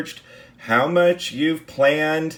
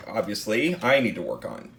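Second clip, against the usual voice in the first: ordinary talk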